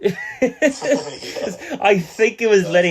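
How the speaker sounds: high slow giggly replay; laughing repeat. Retold or imitated. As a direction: savour the line while cracking up.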